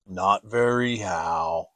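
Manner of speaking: slow, low glum Eeyore; lightly voiced gloomy donkey. A narrator's reading. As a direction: mope through it like a sad old donkey.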